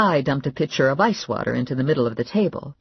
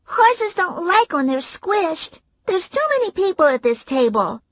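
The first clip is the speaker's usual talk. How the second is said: put-on whiny little girl; 4 dB louder than usual. A narrator's reading. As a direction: complain like a child who thinks she's a horse. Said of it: "Mary Alice said".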